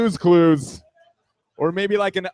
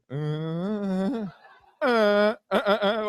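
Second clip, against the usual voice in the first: drawn-out then broken mock moans; independent moans; 2 semitones higher, much slower than usual. Man pretending not to echo her, off-rhythm mock moans. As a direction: moan deliberately off rhythm. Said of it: "these are my independent moans".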